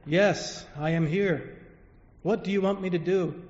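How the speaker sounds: a touch of a booming voice of God; voice from the clouds. Acted. God answers the man from the clouds, lightly booming. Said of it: "a voice says".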